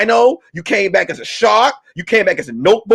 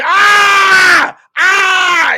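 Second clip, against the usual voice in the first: high mock "ah" screams; retold scream; 11 semitones higher, 3 dB louder than usual. Uber driver bitten in the neck, high mock screaming. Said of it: "He was screaming".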